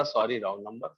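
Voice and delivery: lovingly polite sorry wrong number. Retold or imitated. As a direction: brush off the caller kindly. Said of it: "प्यार से बोलता".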